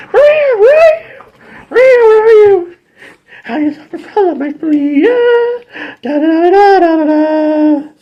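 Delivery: very high, loud cartoon calling; high squeaky voice. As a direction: call out in a silly falsetto. [name] and [name] looking for Maria.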